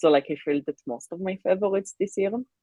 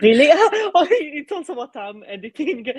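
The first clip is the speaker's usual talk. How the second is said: laughing; 11 semitones higher, 7 dB louder than usual. Real, not acted. Laughing at shared coincidence.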